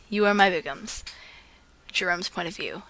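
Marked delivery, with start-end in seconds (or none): 1.9-2.8 whisper. Real, not acted. Announcing the story's point of view.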